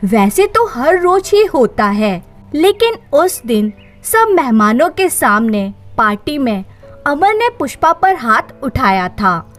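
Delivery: plain reading voice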